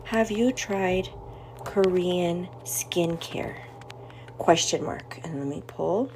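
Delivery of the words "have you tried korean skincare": slow, drawn-out dictation while typing; dictating slowly. Shown as a demonstration. Typing a poll for her viewers, slow dictation. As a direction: spell each word out slowly as you type. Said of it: "let me type it out".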